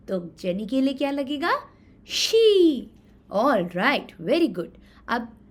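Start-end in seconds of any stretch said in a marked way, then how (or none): 2.1-2.8 much higher, louder she; high emphatic she. Shown as a demonstration. Teacher giving children the answer, higher and louder. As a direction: hand them the answer.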